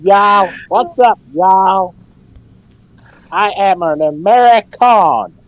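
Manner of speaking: put-on slow, loud American accent. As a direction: drawl it out like a Yank. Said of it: "I had you guys going there".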